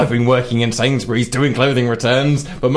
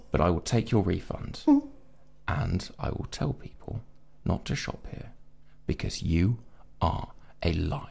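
much softer, near-whispered confrontation; hushed retelling; 6 semitones lower, 13 dB quieter than usual. Retold or imitated. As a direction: drop to a hush for the showdown. Quiet hushed retelling of showdown.

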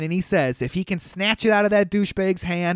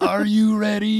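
macho man; 4 semitones higher than usual. Acted. Host repeating the macho line for laughs, wrestler hype voice. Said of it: "That was kinda macho manish".

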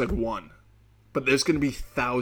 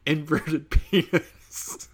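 laughing soldier quote; 4 semitones higher, slower than usual. Retold or imitated. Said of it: "They're like".

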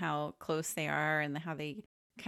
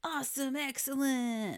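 surfer dude; 9 semitones higher than usual. Retold or imitated. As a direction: stretch it out like a stoked surfer. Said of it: "they're all surfer dudes".

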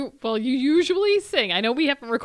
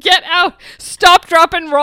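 louder playful yell; shouting; 6 semitones higher, 10 dB louder, slower than usual. Acted. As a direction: yell it like an alarm. Yelling fire advice jokingly.

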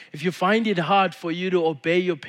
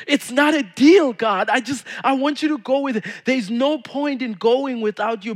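higher, louder, pleading Moses; impassioned plea; 7 semitones higher, 5 dB louder than usual. Acted. Preacher voicing Moses begging God to come along. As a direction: beg God not to leave the people.

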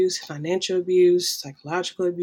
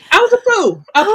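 loud high self-reproach; emphatic; 13 semitones higher, 10 dB louder than usual. Real, not acted. To the host, a loud high self-reproach. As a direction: hammer the admission home. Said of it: "repeated admission".

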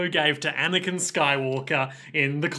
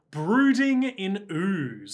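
slow, raised, savoured phrase; drawn out for effect; 4 semitones higher, much slower than usual. Shown as a demonstration. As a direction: stretch it out for listeners to echo. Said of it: "to say aloud".

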